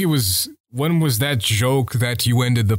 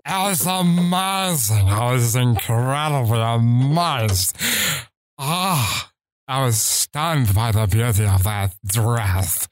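slow, lightly voiced character; drawn-out put-on voice, amazed. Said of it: "I can do this voice as well".

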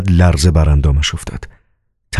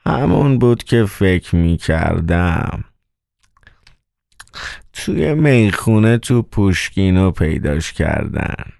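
slow, dragging manager with a sore mouth; drawled words through a smacking, aching mouth; mouth-smacking, toothache voice; 3 dB quieter, slower than usual. A narrator's reading. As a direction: talk slowly as if your tooth aches. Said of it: "صدای مدیر داخلی با ملچ و ملوچ دهان همراه شده بود".

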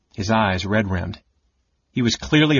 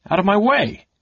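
sharp, high, loud command; startled order; 10 semitones higher, 3 dB louder than usual. Acted. Caramon ordering the young knight aside, loud and startled. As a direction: bark it out, startled. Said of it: "Caramon ordered, startled".

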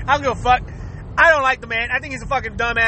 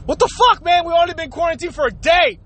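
high-pitched exasperated outburst; mock annoyed; 4 semitones higher than usual. Housemate fed up after one day of quarantine, mock exasperated. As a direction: complain in hammed-up disbelief. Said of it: "you get annoyed just by the hearing".

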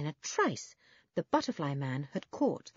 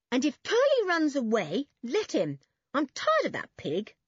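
raised, exasperated voice; higher-pitched brotherly telling-off; scolding older brother; 6 semitones higher, 4 dB louder than usual. Acted. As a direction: warn the little one, fed up with him. Toby threatens, then grudgingly lets Benny come.

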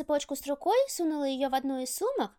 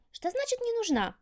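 high, hurt protest; offended child; 8 semitones higher than usual. A narrator's reading. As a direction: sound stung and high. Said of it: "обиделась Мерги". (обиженно, высоким голосом)

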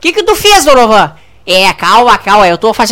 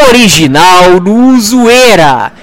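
low, very slow station-ident voice; deep jingle voice; 6 semitones lower, 4 dB louder, much slower than usual. Acted. Show drops its slogan in a deep slow ident voice. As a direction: boom out the tagline like a jingle.

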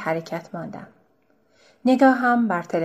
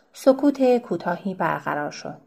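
ordinary delivery, nothing marked

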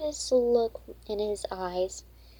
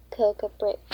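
ordinary delivery, nothing marked

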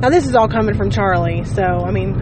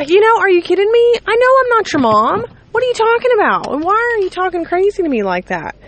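exasperated disbelief; 9 semitones higher, faster than usual. Real, not acted. Parent ranting at a kid's cheeky reply, incredulous outburst. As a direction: let the disbelief burst out.

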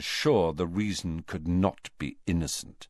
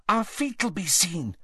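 anxious; 10 semitones higher, 3 dB quieter than usual. A narrator's reading. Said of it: "said Hermione anxiously".

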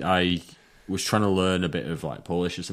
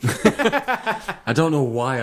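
laughing; 9 semitones higher, 6 dB louder, much slower than usual. Real, not acted. Laughs while talking.

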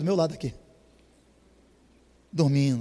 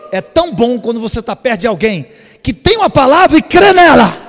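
rising, much louder and higher proclamation; preaching at full force; 8 semitones higher, 12 dB louder than usual. Real, not acted. Preacher proclaiming to the church, building to a shout. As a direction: drive the point home to the congregation.

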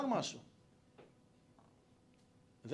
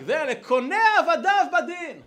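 high, loud, slow proclamation; triumphant prayer cry; 12 semitones higher, 13 dB louder than usual. Acted. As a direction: belt out the verse as the story's climax. Repeats story's shouted prayer climax.

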